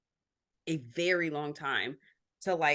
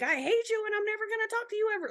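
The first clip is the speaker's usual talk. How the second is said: retold scream; much faster than usual. Brother screaming from a gurney at family, mock scream. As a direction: imitate a screaming outburst, high and rushed. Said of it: "screaming on a gurney like".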